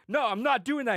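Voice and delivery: hammed-up flat-out no; scared refusal. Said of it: "He's like".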